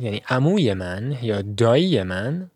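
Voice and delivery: ordinary talk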